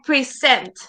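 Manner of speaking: "present" as pre-SENT; teacher's demo of pri-ZENT; stress on second syllable. Shown as a demonstration. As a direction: say "present" with the weight on the last syllable. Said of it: "Present is totally different".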